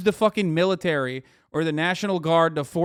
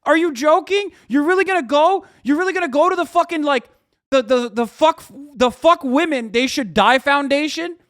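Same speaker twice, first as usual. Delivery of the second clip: high, loud, stunned disbelief; incredulous rant; 7 semitones higher, 4 dB louder than usual. Retold or imitated. Ranting at the newspaper for its interview, high loud disbelief. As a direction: fire it off as if you can't believe it. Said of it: "It's like".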